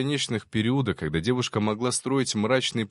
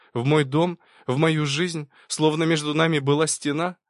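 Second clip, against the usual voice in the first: higher-pitched incredulous questions; indignant challenge; 6 semitones higher, 3 dB louder than usual. A narrator's reading. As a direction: throw the excuse back at him, pitch raised. Narrated woman challenges man's excuse. (возмущённо, с вызовом)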